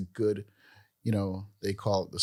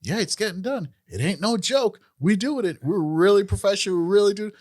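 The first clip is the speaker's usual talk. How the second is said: friend voiced higher and louder, boasting; hammed-up friend; 9 semitones higher, 6 dB louder than usual. Friend announcing his podcast, high boastful mock voice. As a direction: play the proud friend, pitched up. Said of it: "he comes and was like".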